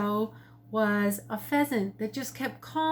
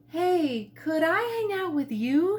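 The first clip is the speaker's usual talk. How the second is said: higher, eager bird voice; eager pheasant; 6 semitones higher than usual. A narrator's reading. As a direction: ask brightly for a friend. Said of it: "he asked".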